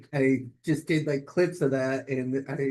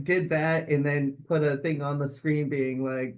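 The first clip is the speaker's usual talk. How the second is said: ordinary talk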